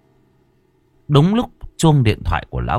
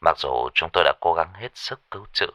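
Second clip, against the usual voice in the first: low, subdued, composed delivery; forced calm; 5 semitones lower, 5 dB quieter than usual. Acted. Caller tells a father of his children's fatal accident, carefully calm. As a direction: break the news gently, keeping composure, with small hesitations. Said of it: "vẫn cố giữ vẻ bình tĩnh".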